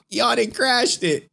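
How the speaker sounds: high, drawn-out incredulity after a laugh; slow it down and pitch it high, incredulous; amused disbelief. Real, not acted. Amused disbelief at site crashing.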